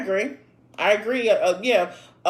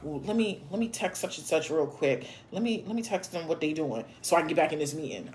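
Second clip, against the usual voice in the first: quiet, fast mock of a busy texter; mock muttered aside; 6 semitones lower, 8 dB quieter than usual. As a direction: mutter it under your breath, hurried. Mocking distracted texter at meeting.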